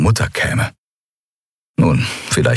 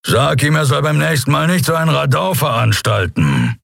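raised voice over engine noise, unimpressed; unimpressed growl; 8 semitones higher than usual. Acted. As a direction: call it out over the din, unbothered. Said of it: "knurrte er unbeeindruckt". (unbeeindruckt über den Lärm hinweg gerufen)

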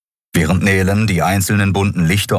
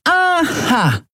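much higher, bright exclamation; exclaimed. A narrator's reading. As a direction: call it out with sudden interest. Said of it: "rief Nelen".

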